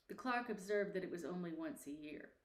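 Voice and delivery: usual speaking voice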